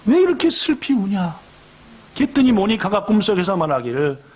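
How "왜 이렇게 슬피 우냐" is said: a dream figure gently asks the weeping mother why; gentle heavenly voice. Acted. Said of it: "그분이 말하기를 이렇게 말했습니다".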